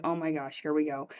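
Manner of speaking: soft, low aside to herself; quietly brace yourself; hushed, overwhelmed self-talk. Retold or imitated.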